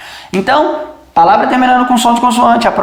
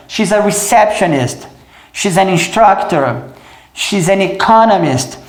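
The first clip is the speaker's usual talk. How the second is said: slow, stressed syllables hit; slow drill aimed at the stress. Shown as a demonstration. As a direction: land on each stressed syllable slowly. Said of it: "sílaba tônica como alvo".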